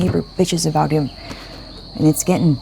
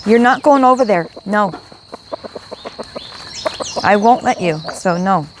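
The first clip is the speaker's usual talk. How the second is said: scolding the dog; 4 semitones higher than usual. Real, not acted. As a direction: tell the dog off sharply.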